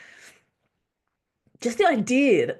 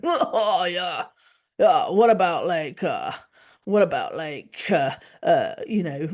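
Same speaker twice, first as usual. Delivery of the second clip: put-on dude bro impression; 3 dB louder, slower than usual. Dude bro griping about sick pay, mocking dude bro accent. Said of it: "That's my do bro accent".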